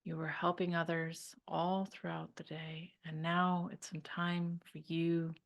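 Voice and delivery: calm meditation voice with long pauses; guided meditation. Real, not acted. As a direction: guide them to unwind, leaving room to breathe.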